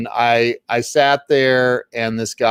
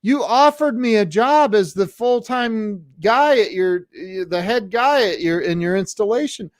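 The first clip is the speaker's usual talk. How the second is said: higher-pitched friend's protest; retold protest; 9 semitones higher than usual. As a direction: remind him in disbelief, voice raised in pitch.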